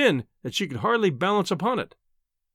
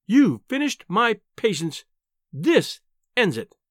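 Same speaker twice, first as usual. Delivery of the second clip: high-pitched, indignant scolding; exasperated wife, dragging out the key words; furious wife; 6 semitones higher than usual. A narrator's reading. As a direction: scold your husband, patience at an end. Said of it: "was in a furious temper".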